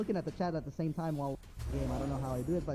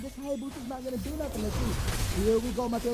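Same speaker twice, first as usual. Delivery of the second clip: rising, excited esports casting; live play-by-play; 8 semitones higher than usual. Acted. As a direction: call the fight fast and hyped.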